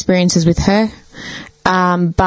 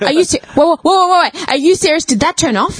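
mock surprise; 8 semitones higher than usual. Teasing her brother after the TV goes off, high fake shock. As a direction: play innocent, all wide-eyed shock. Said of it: "And I'm just like".

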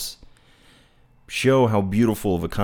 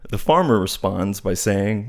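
ordinary talk

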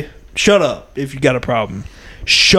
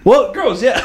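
higher, insisting counter-voice; arguing with myself; 9 semitones higher than usual. Pretend. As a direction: answer yourself back in a higher voice. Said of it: "I'm literally arguing with myself right now".

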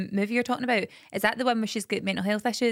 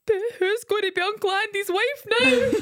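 put-on worried little boy; 13 semitones higher, 3 dB louder than usual. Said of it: "He went".

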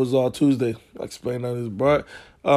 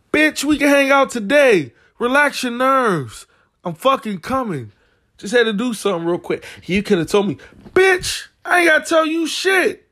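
heated retort; 10 semitones higher than usual. Retold or imitated. Retold angry reply to girl.

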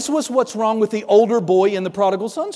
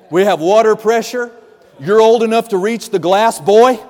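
mildly exasperated, louder fatherly scolding; exasperated dad; 5 dB louder than usual. Retold or imitated. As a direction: lay it down for the boy, emphatically. Exasperated father scolding his son.

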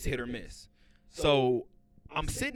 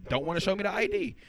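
exasperated; 9 semitones higher, 4 dB louder than usual. Retold or imitated. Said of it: "I was like".

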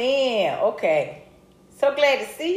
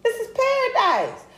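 high dreamy echo; 13 semitones higher than usual. Shown as a demonstration. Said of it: "repeated much higher".

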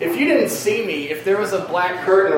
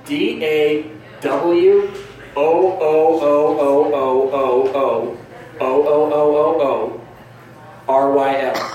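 slow, deliberate letter-by-letter spelling; drawn-out spelling; 3 semitones lower, much slower than usual. Acted. Comedian spelling out their name absurdly, very slow. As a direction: drag out the run of O's one by one. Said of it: "start spelling it like".

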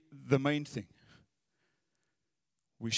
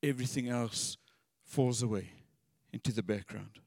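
soft, lower, letting it fade away; quiet yearning prayer; 5 semitones lower, 5 dB quieter than usual. Acted. David telling the Lord he needs him, hushed. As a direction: let the words fall away softly. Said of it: "he just said".

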